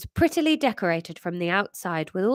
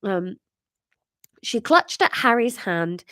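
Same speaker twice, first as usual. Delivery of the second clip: ordinary talk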